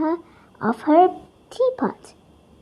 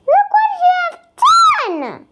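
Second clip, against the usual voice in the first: high, shrill-free furious shout, much higher; angry yell; 4 dB louder, faster than usual. A narrator's reading. Billy yelling at Jason over her smashed teapot. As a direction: yell it at the bully who broke it. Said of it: "she yells loudly".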